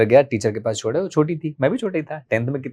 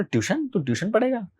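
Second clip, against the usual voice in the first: high, softer mock disbelief; imitated incredulous father; 9 semitones higher, 6 dB quieter than usual. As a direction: play dad astonished, pitch jumping up. Father's disbelief at son's request. (पापा की नकल, ऊँचे सुर में हैरानी)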